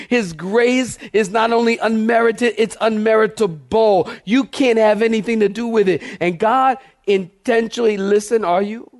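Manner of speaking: usual speaking voice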